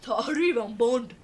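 slow, drawn-out mock Québécois accent. [name] teasing on stream, slow Québécois accent. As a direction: lean into a playful Québécois accent. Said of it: "Je fais mon accent québécois".

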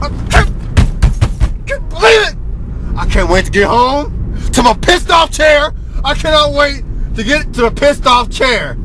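high, loud furious yelling; outraged shouting. Real, not acted. Reviewer fuming to viewers after the movie, screaming in outrage.

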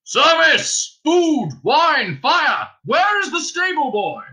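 loud, high, calling out over the storm; booming call. A narrator's reading. Primus booming for service outside the inn over the wind. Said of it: "a deep voice boomed".

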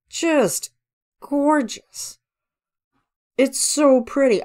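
gushing. Real, not acted. Gushing over the video.

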